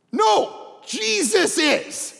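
loud, slow, stretched out on Jesus; preacher shouting the point; emphatic shout. Real, not acted. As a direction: hammer it home to the crowd. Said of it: "preacher emphasis, shouting".

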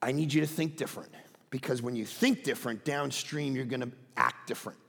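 low, soft, matter-of-fact teacher; Jesus. Acted. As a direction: drop low and quiet to instruct earnestly. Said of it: "he begins to go".

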